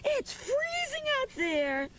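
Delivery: high, slow scolding mother voice; a mother calling her child back from the door; mother's voice. Acted. Mother scolding Danny about the open door, high and slow. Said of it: "said Mother".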